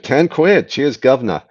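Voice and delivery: badly faked North London accent; pathetic North London accent. Shown as a demonstration. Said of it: "My pathetic, um, North London, uh, accent".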